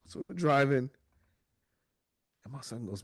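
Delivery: normal talking voice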